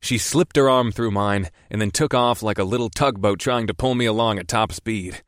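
ordinary delivery, nothing marked

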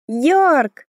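put-on high, loud little-girl squeal; excited squeal. A narrator's reading. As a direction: squeal the name in delight. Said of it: "взвизгнула Ривия". (восторженный детский визг)

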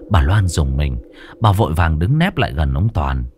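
ordinary delivery

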